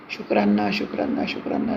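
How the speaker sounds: breathy whispered शुक्राना; whispered thanks. Real, not acted. (फुसफुसाकर शुक्राना)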